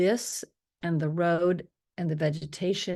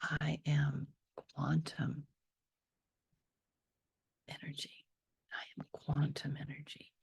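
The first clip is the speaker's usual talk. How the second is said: slow, drawn-out soft whisper; slow whispered affirmation; 7 dB quieter than usual. Shown as a demonstration. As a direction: stretch quantum out in a whisper. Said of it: "And then".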